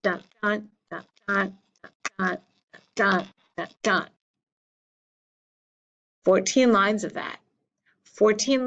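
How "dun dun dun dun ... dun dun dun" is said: "dun DUN" in separate pairs, second one stressed; iambic beat. Shown as a demonstration. Teacher demonstrating where the beat falls in an iamb, iambic beat. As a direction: tap out dun DUN five times with small gaps. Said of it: "The second syllable in each iamb gets the beat".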